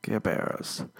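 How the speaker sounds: ordinary talk, nothing marked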